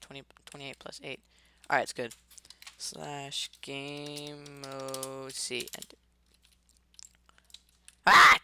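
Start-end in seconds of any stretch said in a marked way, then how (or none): 2.8-6.0 soft, very slow command; quiet slow dictation. Real, not acted. Repeats the command quietly, slowly. 8.1-8.4 sudden high yelp; startled yelp. Real, not acted. Startled cry at gravel.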